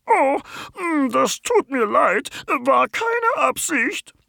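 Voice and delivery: very high, slow apologetic creature voice; a small creature saying sorry; sheepish apology. Acted. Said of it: "antwortet das Zottelding". (sehr hohe, langsame, verlegene Entschuldigung)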